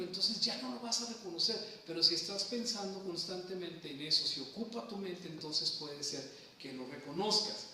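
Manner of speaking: normal talking voice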